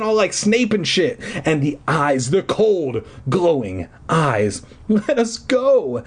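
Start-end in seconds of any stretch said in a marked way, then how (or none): 4.9-6.0 put-on high, slow boy's demand; brave demand. A narrator's reading. Eddie demands the man let them go. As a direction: stand up to him. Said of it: "Eddie demanded bravely".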